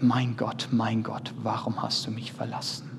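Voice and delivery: low, soft plea, fading away; understated lament, sinking in pitch and volume; forsaken plea. Acted. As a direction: let the forsaken question die away quietly. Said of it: "Jesus formuliert".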